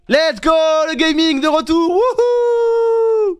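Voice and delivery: high, loud cheer rising into a whoop; mock hype. Streamer celebrating gaming's return, over-hyped cheer. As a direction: whoop it up, ending on a long held "wouhou".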